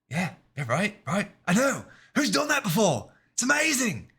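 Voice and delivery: high, mock British director voice, pleased with himself; hammed-up British accent, gushing. Mocking a filmmaker bragging about grain, in a high British accent. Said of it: "I gave him a British accent".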